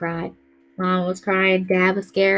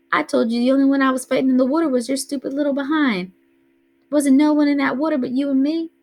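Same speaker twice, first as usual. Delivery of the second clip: lightly voiced older brother, exasperated and higher; a hint of the scoffing big brother; teen big brother; 6 semitones higher, 4 dB louder than usual. A narrator's reading. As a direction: Byron brushing off Kenny's wool poo fears, exasperated. Byron voiced by narrator.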